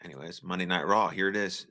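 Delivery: usual speaking voice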